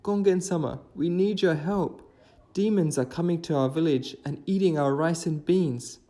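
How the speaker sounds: pleading villager. Acted. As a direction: beg the god for help. Villagers plead with Gongen-sama.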